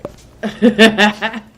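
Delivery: big burst of laughter; loud laugh. Real, not acted. Laughs at rant.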